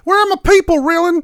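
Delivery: high, loud furious shriek. Acted. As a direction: shriek it out in fury. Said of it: "take three".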